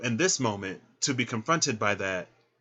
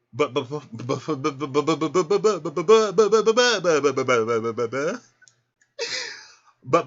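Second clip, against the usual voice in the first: rapid-fire stammer swelling higher and louder; playful stuttering; 5 semitones higher, 6 dB louder than usual. Pretend. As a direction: rattle the word like a motor revving. Playful stuttered run before her point.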